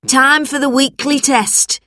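high-pitched classroom call; shouted announcement. A narrator's reading. Teacher announces the test, shouted high. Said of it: "shouted Missus Bleak".

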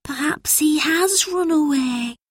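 put-on high, slow boy's musing; anxious child's wondering voice; worried. A narrator's reading. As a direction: fear the worst, drawing it out. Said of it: "He was very worried".

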